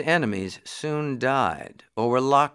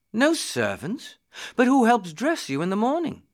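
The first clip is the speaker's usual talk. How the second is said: high-pitched, incredulous question; astonished prince; 10 semitones higher than usual. Acted. Prince baffled that the boy has no servants, astonished. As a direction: react in disbelief, pitch leaping up.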